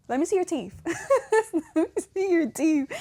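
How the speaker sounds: high-pitched mock mom, breaking into laughs; laughing mom imitation. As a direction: imitate mom's demand through giggles. Retelling mom's demand, laughing.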